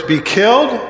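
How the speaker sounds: as if he can't believe his ears; shocked disbelief. Acted. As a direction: voice leaps up high in stunned disbelief. Peter hears of Jesus' death.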